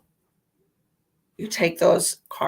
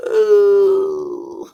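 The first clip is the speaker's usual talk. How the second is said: high whiny groan; 13 semitones higher, 9 dB louder than usual. Real, not acted. Groaning about stuffy nose.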